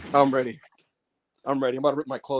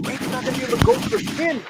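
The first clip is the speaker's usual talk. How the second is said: hyped; 8 semitones higher, faster than usual. Acted. Wrestling hype call.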